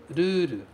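"ruru," with a tapped R and a long final u; Japanese ruru. Shown as a demonstration. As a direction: model "ruru," with flap Rs and a long u. ("ruru" com R batido e u longo)

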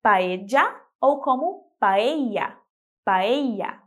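paella first with dj, then with a y glide; paella, paedja then paeya; two ways of ll. Shown as a demonstration. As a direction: paella both ways slowly. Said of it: "pode ser falado como paella".

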